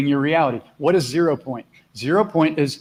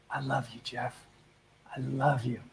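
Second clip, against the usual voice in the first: softly, tenderly to the mirror; soft self-love; 3 semitones lower, 14 dB quieter than usual. Shown as a demonstration. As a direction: say it gently to yourself in the mirror. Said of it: "Can you look in the mirror and go".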